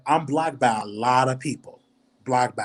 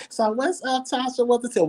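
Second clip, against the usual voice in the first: much higher, put-on voice; high pitch; 12 semitones higher than usual. Shown as a demonstration. Greeting Tasha on a call in a mock high-pitched voice. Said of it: "I can't go in there with no high pitch".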